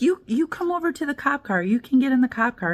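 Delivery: high, coaxing mock cop voice; sweetly lures her into the car; imitating the cop. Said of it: "And they're like".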